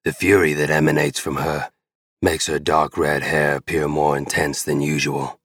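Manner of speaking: ordinary delivery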